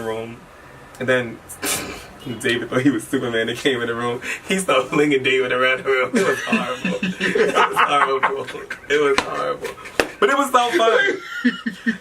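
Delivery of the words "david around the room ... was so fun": real laughter through the words; laughing. Real, not acted. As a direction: say it cracking up, stretching the words. Laughs remembering the fight.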